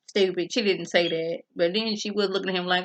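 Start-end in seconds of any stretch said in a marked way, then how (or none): none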